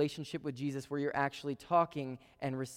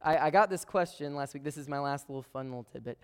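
ordinary talk, nothing marked

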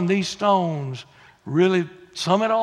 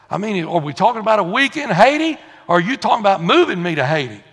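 louder, quick-fire incredulous questions; mock protest; 3 semitones higher, 7 dB louder, faster than usual. Reluctant Baptist questions the Lord about Haiti, mock protest. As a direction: rattle it off like a reluctant recruit. Acted balking at God's call.

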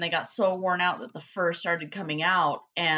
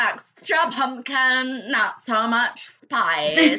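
high, loud, very drawn-out parody; mock latte-girl voice; 5 semitones higher, 5 dB louder, much slower than usual. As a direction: drag out every word, playing the fussy coffee girl. Joins in the put-on latte-girl voice.

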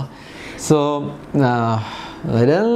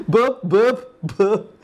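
put-on higher, French-accented repeated name; 6 semitones higher, much slower than usual. Retelling Kevin Kline's answer, French accent. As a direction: imitate a Frenchman insisting on the name. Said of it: "in his French accent".

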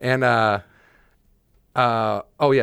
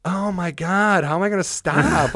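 high-pitched mock-panicked cry; retold panic; 9 semitones higher than usual. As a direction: act out realizing you can't stop, voice pitched up. Acting out panic speeding downhill.

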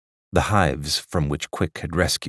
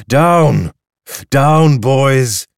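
loud, high urgent call; crying out; 7 semitones higher, 10 dB louder, much slower than usual. A narrator's reading. Quick calling the drones down over the park, loud. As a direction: yell it after the drifting swarm. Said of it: "cried Quick".

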